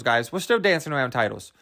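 ordinary delivery

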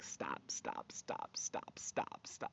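urgent whisper; whispered heart. Retold or imitated. Her heart begging her to leave the meeting, whispered. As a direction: whisper it like your own heart pleading. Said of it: "my heart was just like".

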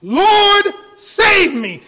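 high, loud cry for help; desperate cry. Retold or imitated. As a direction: cry out like a sinking man. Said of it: "he cried out".

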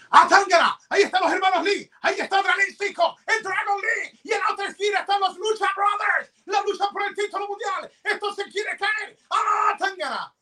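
high, shouted wrestling ring call; lucha libre announcer. Pretend. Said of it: "vamos a irnos suponiendo que estamos en un evento grande".

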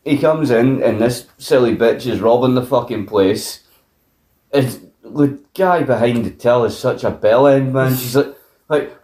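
normal talking voice

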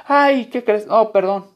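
a hint of girlfriend apologising lightly. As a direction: play the girlfriend brushing it off. Girlfriend excuses forgotten gift.

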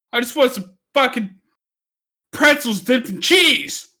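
real exasperated rant; angry rant. Real, not acted. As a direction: vent it like you're fed up with your own town. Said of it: "So upset".

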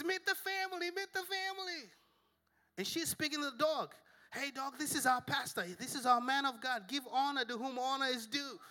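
high sweet lady voice; imitating the lady. Dog owner introducing pastor to the family, high sweet. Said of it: "the lady says".

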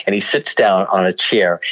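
usual speaking voice

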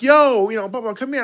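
high, loud mock call across a lobby. People in the lobby hail him as he passes, calling out. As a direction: imitate someone hailing a friend.

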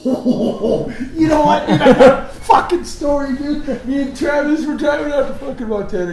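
loud, high, laughing story launch; hyped-up storyteller yelling; excited shout. Real, not acted.

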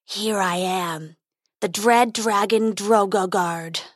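slow, drawn-out dragon growl. A narrator's reading. Dragon appears before the challenging prince, growling slowly. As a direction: announce yourself as a dread beast. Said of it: "growled the dragon".

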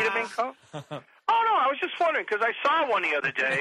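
high, fake-casual chatter; hammed-up innocent backpedaling; desperate diversion. Acting out desperate change of subject.

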